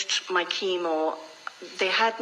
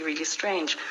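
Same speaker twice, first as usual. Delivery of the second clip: ordinary delivery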